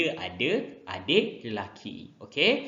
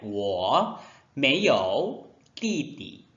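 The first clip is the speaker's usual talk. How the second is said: 我没有弟弟 disebut sangat perlahan; ayat contoh perlahan; much slower than usual. Shown as a demonstration. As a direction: baca 我没有弟弟 perlahan dan jelas. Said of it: "contoh ayat". (我没有弟弟 perlahan seperti guru)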